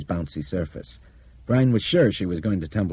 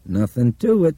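drawl; 7 semitones higher, 7 dB louder than usual. Acted. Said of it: "Ace Costner drawled".

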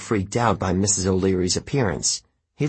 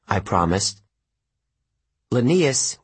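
ordinary delivery

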